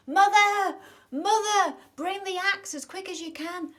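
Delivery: very high, very loud yell for mum; boy screaming for his mother to bring the axe; urgent calling. Acted. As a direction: shout it down the garden to mum.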